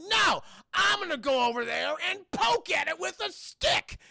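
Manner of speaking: high, loud cartoonish bravado; hammed-up stubborn old man's shout; defiant declaration. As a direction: shout it with foolhardy resolve. Acting old man's foolish resolve.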